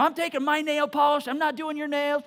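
loud, high tearful squabble; squabbling kid on the verge of tears; fighting girls. Retold or imitated. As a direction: daughters fighting over nail polish, loud and tearful. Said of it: "they're fighting over nail polish".

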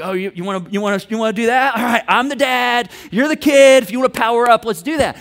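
loud, escalating challenge; combative dad. Acted. Previous [name] powering up against his kid, combative. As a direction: take up the fight and pull rank. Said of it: "previous [name] would have engaged".

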